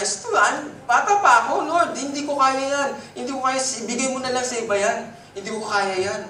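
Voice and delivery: put-on high, whiny young man begging off; pleading young Jeremiah. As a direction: beg to be excused, sounding young. Voicing Jeremiah refusing God. (mataas at nagmamakaawang boses ng batang Jeremiah)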